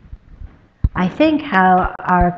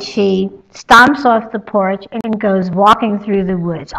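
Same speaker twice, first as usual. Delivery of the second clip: ordinary talk, nothing marked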